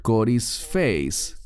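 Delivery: corisfeis said slowly, syllable by syllable; slow, deliberate corisfeis; careful Greek word. Shown as a demonstration. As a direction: spell out corisfeis slowly for the class. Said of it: "es la palabra".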